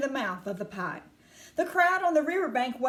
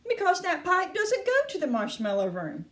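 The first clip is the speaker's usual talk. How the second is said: Wonka answering; 7 semitones higher than usual. A narrator's reading. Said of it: "Mister Wonka answered".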